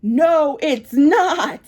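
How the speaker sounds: high, loud insistent no; firm loud denial to the stylist. Retold or imitated. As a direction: shut it down hard. Flat denial in argument.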